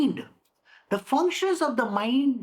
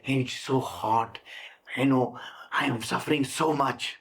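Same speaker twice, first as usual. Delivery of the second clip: self-pitying sick person; 11 semitones lower than usual. Shown as a demonstration. Teacher playing a self-pitying patient, low and moaning. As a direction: act a miserable person feeling sorry for themselves.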